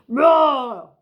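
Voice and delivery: big loud hammed-up roar; T-Rex roar. T-Rex roaring at the end of his letter. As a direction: let out a dinosaur roar.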